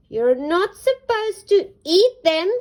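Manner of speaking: high, loud duck shout. Acted. Dora catches Rupert eating seeds, loud shout. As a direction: yell it at the thief. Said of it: "shouted Dora".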